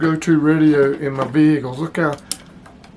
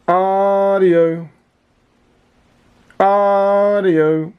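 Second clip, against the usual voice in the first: long, raised "Audio" called into the mic; drawn-out radio test call; 4 semitones higher, 4 dB louder, much slower than usual. Real, not acted. Keying the radio for the power test, a long raised call. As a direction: stretch it out like a mic check.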